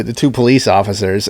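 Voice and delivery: ordinary delivery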